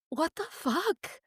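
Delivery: high, soft, drawn-out re-enacted shock; retold stunned disbelief. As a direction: relive the disbelief, slow and hushed. Retells her shocked reaction to news.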